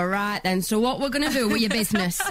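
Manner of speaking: broad Yorkshire, plain-spoken business talk; thick Yorkshire delivery to a London client; strong Yorkshire accent. Retold or imitated. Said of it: "my accent gets stronger".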